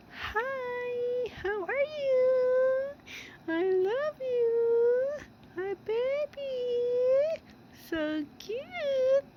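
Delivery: very high, slow cutesy pet voice; voicing the cat. Owner answers viewers as her cat in a high voice. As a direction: squeak the hello for the kitten. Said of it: "say hi to our viewers".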